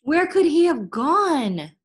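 high, loud and slow, anxious; girl searching the market for her lost brother, anxious; worried. A narrator's reading. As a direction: call out in rising panic.

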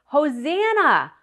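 high, loud shouts of praise; shouting. Acted. Crowd hailing Jesus on Palm Sunday, shouting. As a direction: call it out like a cheering crowd. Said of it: "they were shouting".

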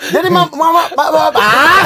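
high, loud stammer bursting into a cry; Dev Liber. Retold or imitated. Comic imitating a tongue-tied comedian, high frustrated stammer. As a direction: get stuck on the word, then blurt in frustration. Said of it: "Dev Liber begini".

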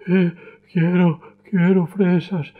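high, slow mock crying child; a hint of tearful whimper; boy breaking into tears; starting to cry. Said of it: "Empieza a llorar".

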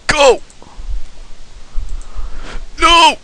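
very high game shouts; high, urgent cries; frantic yelling. Real, not acted. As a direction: yell in a squeal at the screen. Yelling during game fight.

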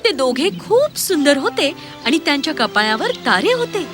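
ordinary delivery

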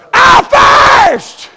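very high, drawn-out preaching cry; shouted quote. Retold or imitated. Preacher repeats Jesus's words from the cross, shouted quote. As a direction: belt out the two words, stretched long.

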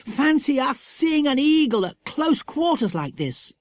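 very high, thrilled wonder; utmost delight. A narrator's reading. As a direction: marvel at your luck. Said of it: "said Jack in the utmost delight".

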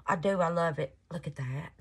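ordinary talk, nothing marked

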